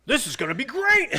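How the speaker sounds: loud, high gleeful yell; imitated excitement. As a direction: shout it like an overexcited director. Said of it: "He's so excited we have a tripod".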